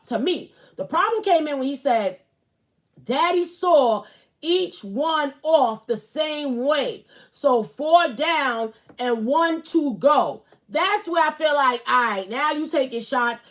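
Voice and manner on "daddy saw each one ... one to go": very slow, deliberate read-back; slow, pointed quote. Retold or imitated. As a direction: spell out the offending words slowly, phrase by phrase. Requotes the petty part pointedly.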